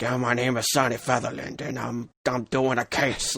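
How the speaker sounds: a hint of hard-boiled detective narration; Sonny Featherland noir voice. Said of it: "he's talking like that".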